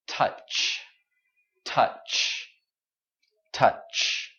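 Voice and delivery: "touch" whispered with a crisp voiceless ch at the end. Shown as a demonstration. Teacher whispering touch to show the final ch. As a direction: whisper "touch", ending on a sharp ch. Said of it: "if I say".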